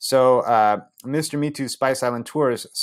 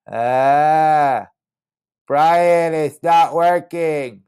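louder, higher, slow complaint; frustrated cry; 6 semitones higher, 6 dB louder, much slower than usual. Retold or imitated. Narrator complaining to his friend that the seed fails, loud slow whine. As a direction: whine that it won't work.

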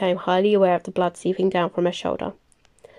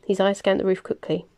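ordinary delivery

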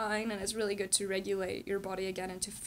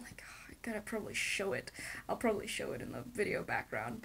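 whisper; 2 semitones lower, 8 dB quieter, faster than usual. Real, not acted. Aside about showing it later.

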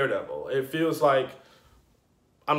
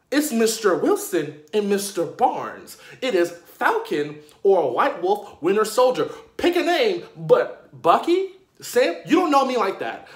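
high, emphatic scolding; indignant correction; 5 semitones higher, 4 dB louder than usual. Acted. Fan demanding proper titles for the heroes, indignant. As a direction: correct them sharply, hitting each name.

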